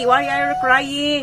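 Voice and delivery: high, slow cooing question. Real, not acted. Comforting crying baby.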